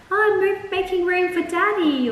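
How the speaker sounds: put-on high, sing-song little girl voice; little child voice. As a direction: chirp it like an innocent toddler. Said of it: "she just randomly that night was like".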